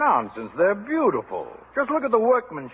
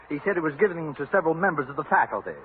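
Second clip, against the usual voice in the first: usual speaking voice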